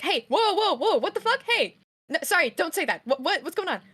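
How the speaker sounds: high, loud, laughing alarm while marching over; alarmed outburst. Acted. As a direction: rush in, shocked at what the kid is doing. Rushing over, alarmed at child.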